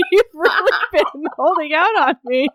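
high, delighted laughing tease. Real, not acted. Cohost teasing her friend about hidden acting talent, laughing. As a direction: talk through real laughter.

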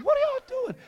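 high, loud baffled outcry; mock astonishment. Preacher to cheering kids, mock astonishment. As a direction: cry it out in acted disbelief. Startled reaction to jumping kids.

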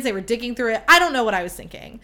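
ordinary delivery

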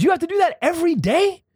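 high, curious hustler voice. Retold or imitated. Hustler asks about shaving, high curious voice. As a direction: ask like a slick street salesman.

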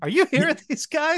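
high, disbelieving mock aside to the camera; imitating a knowing glance at the audience; incredulous aside. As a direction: act out the look: can you believe these guys. Eddie Murphy's glance to camera.

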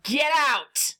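in-character shout; acted shout. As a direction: shout it as an order to clear out. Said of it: "look into the room and shout".

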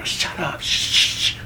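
hushed, whispered telling-off; whispered shut-up with hissing shushes; shushing the hollerers. Acted. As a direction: shush them in a sharp whisper. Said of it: "And they go".

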